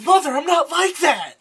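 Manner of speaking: high, hammed-up protest to mother; mock Penguin. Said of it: "Penguin's like".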